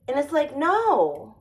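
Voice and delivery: ordinary talk, nothing marked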